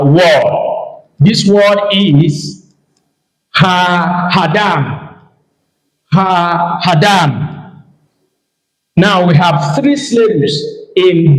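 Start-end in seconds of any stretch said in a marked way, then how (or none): 3.5-7.8 "ha-hadam" drawn out at half pace; slow demonstration. Shown as a demonstration. Teacher showing a three-syllable word to learners, slow demonstration. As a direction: model the word slowly, pausing after "ha". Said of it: "I will show you example through this".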